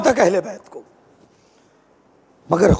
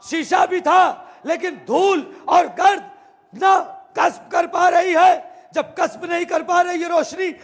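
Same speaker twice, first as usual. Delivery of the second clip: fiery preaching; 6 semitones higher than usual. Acted. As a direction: preach it out to the whole gathering, full force. Preacher builds to full force. (जोशीला ऊँची आवाज़ में प्रवचन)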